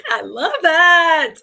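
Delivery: high, loud, laughing exclamation; drawn-out joyful squeal through a laugh; delighted. Real, not acted. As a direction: belt it out slowly with gleeful laughter.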